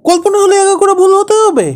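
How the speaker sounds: very high, loud indignant yell; furious shout. Acted. Husband yells back at wife about his story, furious. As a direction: explode in outraged disbelief.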